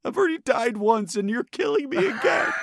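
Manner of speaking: high, loud melodramatic ghost wail; hammed-up ghost wailing at breakup. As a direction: cry out that you're being killed again. Ghost wails at being dumped.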